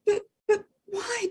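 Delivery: high, soft, stammering dog voice; a small dog asking, hurt; high puppy voice, hurt; hurt little dog. Acted. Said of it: "Dog said".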